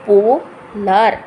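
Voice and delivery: पोलर drawn out slowly, syllable by syllable, पो then लर; slow syllable demo. Shown as a demonstration. Teacher slowly sounding out पोलर for students. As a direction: stretch पोलर into पो and लर, slowly and clearly. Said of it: "पहले ये पो कर लीजिए".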